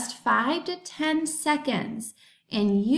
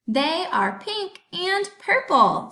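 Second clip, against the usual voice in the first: higher, louder and slower with delight; excited; 7 semitones higher, 3 dB louder, slower than usual. Shown as a demonstration. Teacher showing her joy at her favorite colors, excited. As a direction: gush about your favorite colors. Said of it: "I am pretty excited".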